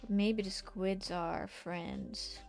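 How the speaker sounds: ordinary delivery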